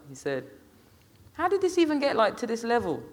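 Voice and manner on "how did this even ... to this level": put-on high-pitched, incredulous teenage girl; girl amused, incredulous. A narrator's reading. Girl asking how it got this far, amused disbelief. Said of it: "asked the girl, laughing".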